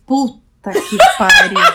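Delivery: loud, slow curse breaking into a laugh. Retold or imitated. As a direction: swear through a laugh of disbelief. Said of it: "E aí eu falei". (palavrão alto entre risadas)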